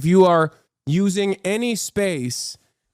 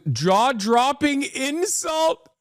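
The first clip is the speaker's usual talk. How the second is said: sarcastic; 7 semitones higher, much slower than usual. Real, not acted. Sarcastic reaction to clip.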